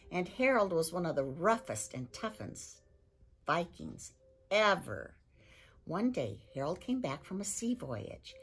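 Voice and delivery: lively storybook read-aloud; reading to children. A narrator's reading. Librarian reading chapter one to children, lively storytelling. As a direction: read it like a bedtime story for kids.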